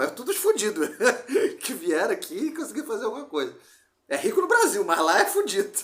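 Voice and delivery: loud, high, laughing through the words; laughing joke. Real, not acted. Man joking to friend about his relatives abroad, laughing loudly. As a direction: crack up loudly while telling it.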